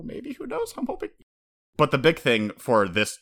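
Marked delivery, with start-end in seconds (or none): 0.0-1.1 wistful falsetto. Real, not acted. Hopeful musing, falsetto.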